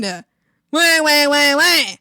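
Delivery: loud, mock baby-cry wahs; mocking wail. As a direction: jeer with fake wailing. Mocks coworker with fake wailing.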